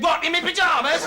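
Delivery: high, worked-up yell; shouting after someone. Acted. [name] yells after the departing boss, worked-up shout. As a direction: call it indignantly down the road. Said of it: "so I ran outside and said".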